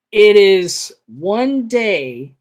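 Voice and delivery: ONE DAY loud and drawn out; emphatic clarification. Real, not acted. Game master re-clearing the timeframe for players, emphatic. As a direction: hit "one day" hard, stretching each word.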